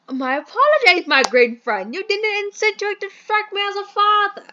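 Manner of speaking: a booming put-on English gentleman; posh British gentleman. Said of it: "I hate this accent".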